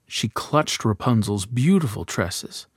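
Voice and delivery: ordinary delivery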